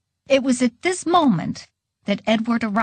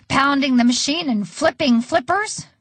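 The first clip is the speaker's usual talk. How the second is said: usual speaking voice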